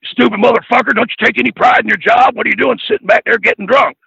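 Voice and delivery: hammed-up heel promo rant; mock berating promo. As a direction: chew out the rookie like a wrestling promo. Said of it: "cutting promos on me".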